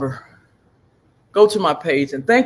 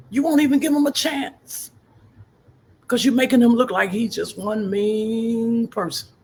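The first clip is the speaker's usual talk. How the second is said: preaching; 10 semitones higher than usual. Real, not acted. Grandmother preaching to her viewers about Jesus's open arms, fervent. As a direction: testify it like a sermon.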